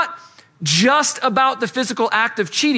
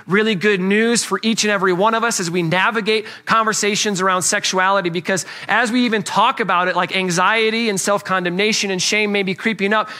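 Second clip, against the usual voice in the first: ordinary talk, nothing marked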